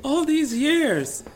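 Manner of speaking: high, loud, drawn-out wail; mock betrayed child. Guest plays the son feeling duped for years, mock betrayed wail. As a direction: stretch it out in hammed-up dismay.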